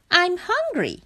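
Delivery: high-pitched little frog complaint; high storybook frog voice; complaining frog. A narrator's reading.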